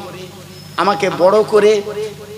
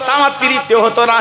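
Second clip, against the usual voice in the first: Prophet answering companions; 8 semitones higher than usual. Acted. Prophet tells companions who the old woman is, impassioned and high. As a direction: cry it out as the Prophet defending his milk mother. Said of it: "আমার নবী বলে".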